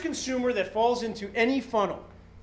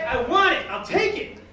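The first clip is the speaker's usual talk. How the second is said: loud, higher, mock-excited buyer; eager shopper; 4 semitones higher, 8 dB louder than usual. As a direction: blurt it like a shopper who can't resist. Acted eager online shopper buying.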